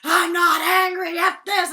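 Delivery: loud, high, slowed mock fury; angry denial. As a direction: shout it while storming out. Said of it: "Instead, he like".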